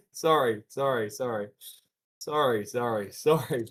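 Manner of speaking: loud, laughing string of sorries; laughing apology. Real, not acted. Streamer apologising for a harsh joke, laughing. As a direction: apologise over and over through laughter.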